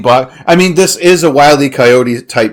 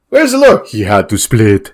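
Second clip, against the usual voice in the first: Schwarzenegger; 5 semitones lower than usual. Acted. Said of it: "that sounds like a Schwarzenegger".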